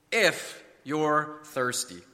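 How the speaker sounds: "If you're thirsty" word by word, very slow; slow and deliberate. Shown as a demonstration. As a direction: draw out "If", pause, then "you're thirsty". Repeated slowly to expose the prerequisite.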